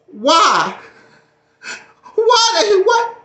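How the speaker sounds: high cracking wail of fake grief; mock crying. Vlogger mock sobbing over lost subscribers. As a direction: ham up the heartbreak.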